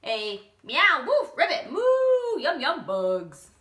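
high, loud silly sounds; animal noises. Acted. Bob the rooster waking the household. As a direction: show every sound again.

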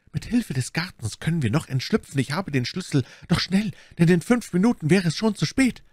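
high, pressing, building in pitch; urgent pleading. A narrator's reading. Milady urges escape through the garden, urgent. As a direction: press them to hurry, voice climbing high.

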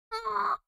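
high honking noise; goose honk. Shown as a demonstration. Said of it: "Would you like to honk".

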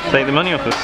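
ordinary delivery

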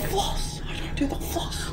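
hushed whisper; nervous night vlog whisper. Real, not acted. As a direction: whisper so nobody hears. Whispering while hiding.

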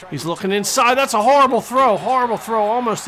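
loud burst of dismay; exasperated call. Real, not acted. Fan calls a horrible throw, loudly exasperated. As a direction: raise the voice at a bad play. Live call of a near interception.

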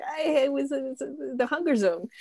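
high, mock-guilty excuse; sheepish excuse. Acted guilty excuse to roommate.

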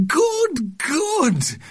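very high cheering aunt; delighted cry. A narrator's reading. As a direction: cheer the baby on, gushing. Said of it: "cried Aunt Janet".

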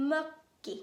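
mökki with rounded ö and a held double k; Finnish ö. Shown as a demonstration. Teacher models mökki for students, rounded ö. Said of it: "เดี๋ยวเรามาฝึกกันเลยค่ะ".

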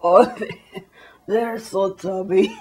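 snooty mock-European amusement. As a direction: chuckle at them through the words, slowly. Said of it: "Europeans are all like".

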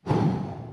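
long audible exhale; demonstrated deep breath, let out as a sigh. Shown as a demonstration. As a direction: breathe out slowly and fully. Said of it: "take a deep breath".

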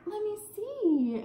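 high, slow eager plea; new mom begging to see the baby, high and slow. Retold or imitated. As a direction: stretch it out high and pleading. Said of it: "I'm like".

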